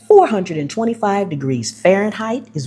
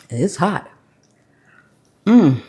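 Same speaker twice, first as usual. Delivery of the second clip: ordinary talk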